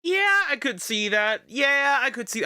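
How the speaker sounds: high, loud eager yeah; excited agreement. Real, not acted.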